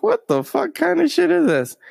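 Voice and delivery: high-pitched mock disbelief; squealed, retold bafflement; baffled outburst. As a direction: blurt it way up high, incredulous. Voicing own baffled reaction.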